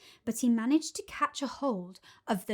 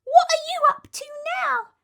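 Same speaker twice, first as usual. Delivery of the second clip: high, bright monkey chirp; cheeky monkey voice; 9 dB louder, faster than usual. Acted. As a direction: play a chirpy little monkey.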